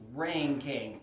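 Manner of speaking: usual speaking voice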